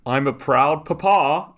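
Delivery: put-on high, slow, proud little boy; smug kid voice. Voicing the boy's post.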